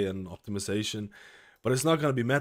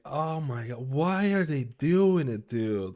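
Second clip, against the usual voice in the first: exasperated; 5 semitones higher, 4 dB louder, much slower than usual. Retold or imitated. Retells his reaction to nerf.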